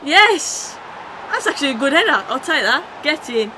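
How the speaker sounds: loud, high whoop of joy at the goal; excited goal celebration. Real, not acted. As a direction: celebrate the header out loud, punching the air.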